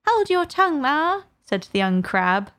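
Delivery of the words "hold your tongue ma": high, sharp snap at mother; snappish. Acted. Young crab snapping at her lecturing mother, high. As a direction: cut her mother off impatiently. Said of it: "a little snappishly".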